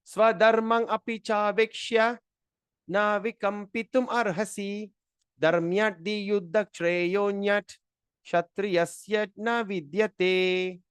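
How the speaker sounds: measured, intoned shloka; Sanskrit verse recitation. Acted. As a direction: chant the verse syllable by syllable, drawn out.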